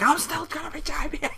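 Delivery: high strained mock yell; mock scream. Wrestler screaming while attacking a leg, high. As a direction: screech it in defiance. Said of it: "and just screams".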